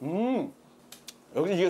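speaking between bites; talking while chewing noodles. Real, not acted. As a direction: mouth partly full. Seen 0: Puffed cheeks, closed-lip chewing right after slurping noodles, then talks.

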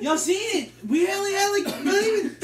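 loud and high, amazed; can't believe it; excited disbelief. Real, not acted. Shocked at tiny amount.

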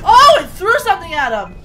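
loud, high burst of alarm; gasp, then shout it in fright; alarmed outcry. Real, not acted. Viewer sees something thrown in footage, alarmed outcry.

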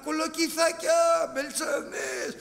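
loud, high hawker's call, stretched out; market vendor cry. Retold or imitated. Preacher imitates a greengrocer at the street market, hawking loudly. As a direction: bellow your vegetables across the market. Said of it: "πηγαίνω στη λαϊκή και ακούω".

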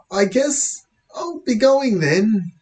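high, slow, hesitant excuse; timid, attempted whisper. Boy trying to leave policeman, timid high voice. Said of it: "I whisper".

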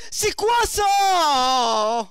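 drawn-out outraged shout. Real, not acted. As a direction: drag the words out in disbelief. Gamer outraged at the game.

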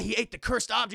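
normal talking voice

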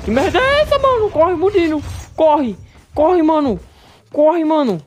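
shrill panicked shout; being chased. Real, not acted. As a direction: scream for him to run. Monster attacks again.

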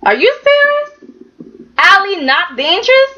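high, disbelieving outburst; shocked, can't believe what she heard; squeaky high what, are you serious; incredulous. Retold or imitated. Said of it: "And I'm like".

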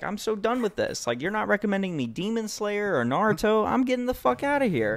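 high-pitched fed-up quote; mock exasperated. Host voicing girlfriends leaving his friend, mock fed-up. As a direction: imitate someone who has had enough and is walking out. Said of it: "They're like".

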